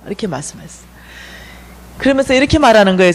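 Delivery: ordinary delivery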